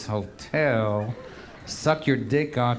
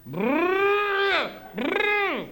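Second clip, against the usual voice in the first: high buzzing metal detector; buzzer noise. Retold or imitated. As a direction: imitate the buzzer twice. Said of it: "They go".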